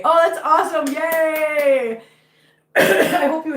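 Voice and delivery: high, loud happy cheer; excited cheer. Real, not acted. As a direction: cheer out loud for a friend's good news. Cheering a viewer's day off.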